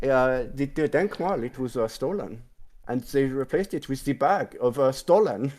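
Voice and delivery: hammed-up mock German accent; terrible German accent. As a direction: deliver it haltingly in a bad German accent. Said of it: "put it into a not accent".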